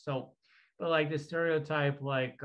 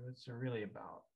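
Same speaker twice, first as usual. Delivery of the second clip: usual speaking voice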